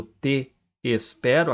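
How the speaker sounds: slow "te espero" with te held apart from espero; both vowels separate. Shown as a demonstration. Teacher pointing out two vowels, "te espero" said te, espero. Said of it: "aqueles dois es do".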